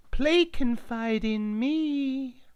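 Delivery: very high, slow sheep voice; talking sheep. Acted. Line-dancing sheep asking for the song, high and slow. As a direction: bleat it out like a silly sheep. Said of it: "one sheep said".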